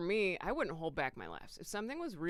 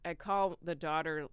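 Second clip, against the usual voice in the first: ordinary talk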